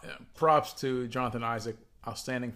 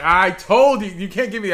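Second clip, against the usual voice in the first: mock gloat; 4 semitones higher, 8 dB louder than usual. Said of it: "so we're like".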